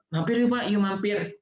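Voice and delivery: hawker's call. Pretend. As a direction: call people in like a street seller. Street hawker calling.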